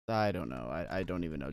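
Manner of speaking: low, quiet resignation; subdued, deflated murmur; defeated. Real, not acted. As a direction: drop soft and low, out of answers. Giving up on explaining failure.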